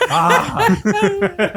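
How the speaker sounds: high, shrieking yelp with laugh; startled laughing yelp. Retold or imitated. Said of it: "Think they're in the car".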